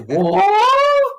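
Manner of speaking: very high, loud shocked "What?"; shocked squeak. Retold or imitated. Host voicing Homer's shock at the duel, high surprised squeal. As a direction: squeal it out in disbelief.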